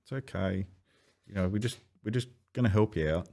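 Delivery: calm, high, gently soothing voice; soothing orderly. Retold or imitated. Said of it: "I'm like".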